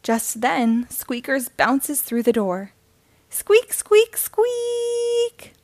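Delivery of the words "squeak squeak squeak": high squeaky squeaks; squeaky mouse voice. Acted. Mouse toy squeaks to show what's wrong, tiny squeaky voice.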